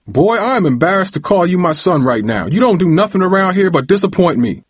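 high, heated dressing-down; furious father's tirade, pitched high; roaring. A narrator's reading. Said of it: "Uncle James roared".

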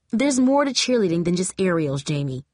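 plain reading voice

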